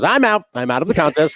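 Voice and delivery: high, loud burst, then easing off; imitating a sitcom character bowing out; emphatic acted announcement. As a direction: blurt it out as a quitter's declaration. Acts Kramer quitting the contest.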